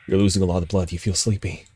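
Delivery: normal talking voice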